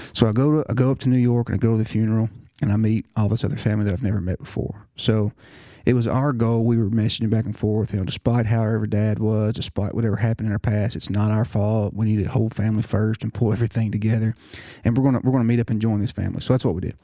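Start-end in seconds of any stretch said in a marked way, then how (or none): none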